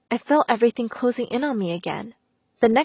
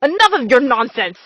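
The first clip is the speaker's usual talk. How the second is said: louder curt angry retort; snapping angrily; 2 semitones higher, 5 dB louder than usual. A narrator's reading. Mister Hopkins silencing Missus Davis, angry louder snap. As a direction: cut her off sharply. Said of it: "snapped back angrily".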